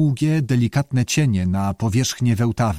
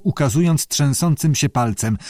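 ordinary delivery, nothing marked

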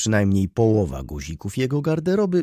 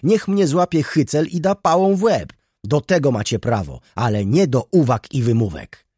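much higher, louder furious outcry; irritated outcry; 6 semitones higher, 5 dB louder than usual. A narrator's reading. Student defying the steward and narrator, furious. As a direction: hurl the defiance at them. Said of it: "mówił zirytowany".

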